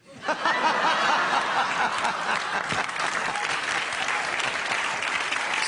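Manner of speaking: silent, wheezing laugh; breathless laughter. Real, not acted. Laughing at his own finger-gun story, wheezing.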